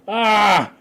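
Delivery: high, loud cry of recognition; excited yell. Retold or imitated. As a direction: burst out with a delighted "ah". Acted cry on finding fellow Australian.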